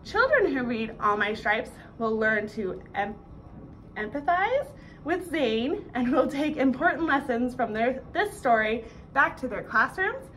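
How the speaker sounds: ordinary delivery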